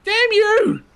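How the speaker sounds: high playful outraged yell; mock angry shout. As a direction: curse your friend in jest. Teammate grabbed all the loot.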